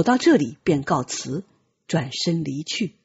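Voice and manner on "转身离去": ordinary delivery, nothing marked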